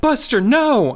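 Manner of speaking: high, loud scream at the dog. Acted. Kid screaming at his runaway dog, loud and high. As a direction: yell no at the dog. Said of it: "I screamed".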